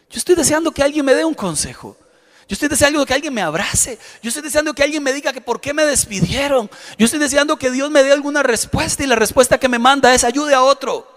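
impassioned preaching. Acted.

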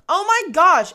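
high, loud exasperated outburst. Retold or imitated. New medium reacts to endless meditation advice, exasperated.

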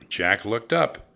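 plain reading voice

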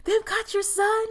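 high, sarcastic fake sympathy; retelling a hacker mocking a mother's plea, high and sarcastic. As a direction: sneer at her worry in a high mock voice. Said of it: "Like, he mocks her".

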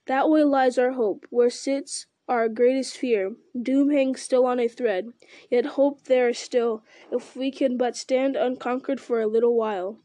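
plain reading voice

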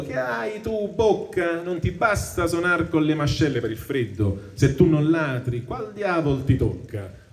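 slow, measured reading of Dante's verse; poem recitation. Acted. Teacher reading the Inferno to the class, measured recitation. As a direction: recite the tercets with weight. Another damned soul shouts, verse.

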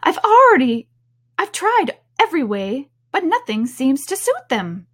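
high, complaining bird voice; exasperated pigeon. A narrator's reading. Pigeon failing to find safety from serpents, exasperated. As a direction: moan it in a high-pitched fluster. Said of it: "added with a kind of a snub".

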